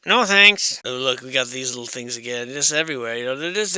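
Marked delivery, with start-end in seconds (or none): 0.0-0.8 high and louder, a flat dismissal; emphatic no thanks. Real, not acted. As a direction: push the room away with a blunt refusal. Rejecting another creepy item.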